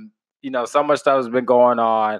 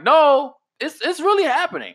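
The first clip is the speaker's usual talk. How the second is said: mock incredulous voice; 13 semitones higher, 3 dB louder than usual. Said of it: "people were like".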